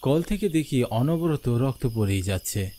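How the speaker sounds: ordinary delivery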